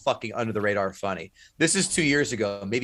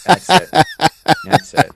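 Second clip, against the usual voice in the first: emphatic agreement; 6 semitones higher, 11 dB louder than usual. Real, not acted. Emphatic agreement in sports banter.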